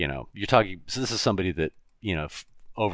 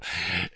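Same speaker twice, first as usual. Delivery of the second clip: brief amused chuckle; short laugh; 3 dB quieter than usual. Real, not acted. Host amused at how the show starts, short chuckle.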